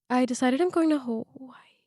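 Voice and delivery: whispering a secret; hushed secret whisper. Real, not acted. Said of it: "it's a secret from my boyfriend".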